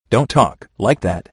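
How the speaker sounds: plain reading voice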